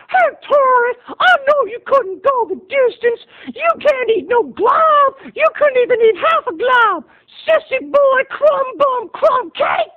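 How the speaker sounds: high-pitched mocking child; high kid voice taunting. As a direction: squeak the insults like a bratty little cousin. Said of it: "Cousin James's voice was still high".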